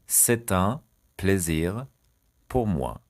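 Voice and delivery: "C'est un", "plaisir", "pour moi" split by pauses; word by word. Shown as a demonstration. Teacher dictating a French phrase to learners, chunk by chunk. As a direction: break the phrase into three separate chunks.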